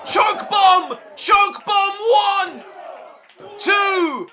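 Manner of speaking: high, loud calls of move and count; excited shouting. Real, not acted. Commentator calling the near-fall to viewers, frantic shouting. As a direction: yell it like the title is changing hands.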